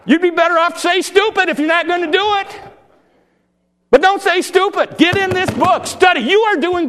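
high, loud fiery exhortation; preaching at full force. Real, not acted. Preacher shouting to his congregation to study the Bible. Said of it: "Hey, this is good preaching".

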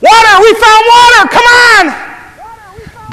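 very high, loud yell; full-out distant holler; calling to camp. Acted. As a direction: bellow it across miles of desert at the top of your range. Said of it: "turns back to the camp".

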